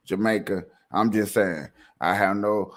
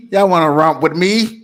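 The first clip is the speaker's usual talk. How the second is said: loud challenge; 6 semitones higher, 11 dB louder than usual. Acted. Challenging the Jamaicans.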